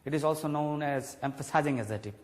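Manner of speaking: ordinary talk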